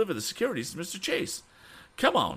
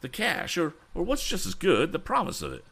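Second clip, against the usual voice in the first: high, excited character voice; much excited; 8 semitones higher than usual. Acted. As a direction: report the good news in a pitched-up flurry of relief. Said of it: "He was much excited".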